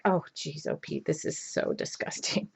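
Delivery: hushed whisper of disgust. Retold or imitated. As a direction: whisper it as if still sitting in the cinema. Disgusted during movie.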